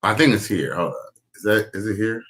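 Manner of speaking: ordinary talk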